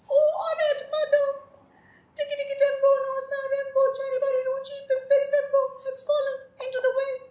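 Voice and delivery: put-on high, fast, crying child; a small boy gasping out his news; breathless little boy. A narrator's reading. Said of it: "he was out of breath after all that running".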